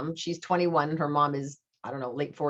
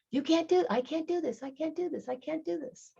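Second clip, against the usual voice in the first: high echo dying away; mock echo; 12 semitones higher, 4 dB quieter than usual. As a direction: let it ring back and fade. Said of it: "guess what it's saying".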